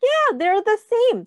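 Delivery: excited praise. Shown as a demonstration. Praising child's answer.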